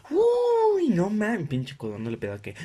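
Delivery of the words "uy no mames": high laughing shriek; mock outraged coworker. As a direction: shriek at the price. Coworkers reacting to gift price. (compañero indignado por el intercambio, chillando)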